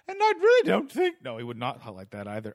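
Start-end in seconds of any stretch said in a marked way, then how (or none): 0.1-1.2 very high, loud mock English voice; squeaky, badly faked English accent. Imitating the English parent, hammed-up English accent. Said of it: "What would he say?".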